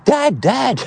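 high urgent mock call of "Dad, Dad."; imitating calling for dad. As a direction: cry "Dad, Dad." high, like a panicked young boy. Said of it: "I'm screaming".

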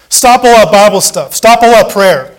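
loud, slow jeering demand; taunting world yelling; mocking shout. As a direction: throw the taunt at him.

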